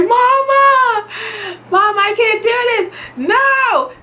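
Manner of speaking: high desperate cry to mom; half-laughing mock panic; panicked plea. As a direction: beg your mother like you're breaking. Begging mom during labor.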